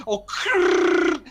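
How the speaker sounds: loud, high "oh" into a long rolled r; shouted curse breaking off in a rolling krrr; imitated cut-off swearing. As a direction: start a Polish curse and get stuck on the trill. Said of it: "they didn't finish the word".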